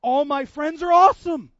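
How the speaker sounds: high, loud, thrilled wonder; loud, joyful astonishment; amazed exclamation. Retold or imitated. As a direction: exclaim it to the whole room. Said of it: "and I'm like".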